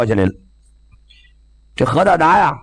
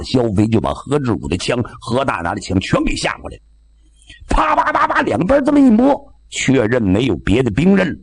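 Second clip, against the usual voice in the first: ordinary delivery, nothing marked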